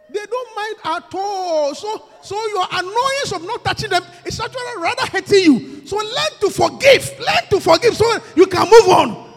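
loud, high, slow preaching cry; urging the congregation at full force; preaching shout. Real, not acted. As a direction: sing out the command to forgive. Preaching climax.

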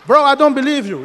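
loud, high incredulous protest; retold disbelief. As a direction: refuse to believe it, exclaiming loudly. Retelling his disbelief to coworker.

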